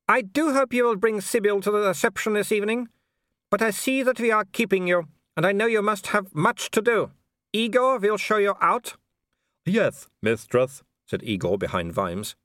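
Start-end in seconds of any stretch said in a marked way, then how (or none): 0.1-9.0 high, polite baroness voice with a thin smile; a smiling aristocrat who means none of it; cool hostess smile. A narrator's reading. 9.6-10.8 very slow, lisping manservant voice; the servant Igor answers his mistress. A narrator's reading.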